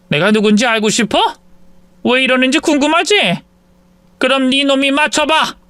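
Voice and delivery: high, taunting menace; furious mother-in-law taunting her son's wife's lover; menacing taunt. Acted. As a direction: tease him before the next blow.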